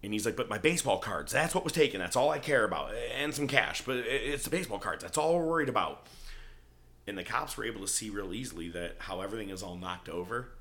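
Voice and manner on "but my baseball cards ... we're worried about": loud, high mock fretting; mock whining protest. Robbed dork complaining to cops about baseball cards, mock-fretful. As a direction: imitate a worked-up, whiny victim.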